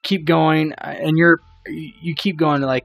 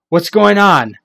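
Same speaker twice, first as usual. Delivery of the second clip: impatient urging; 5 semitones higher, 7 dB louder than usual. Acted. Damon urges them on impatiently.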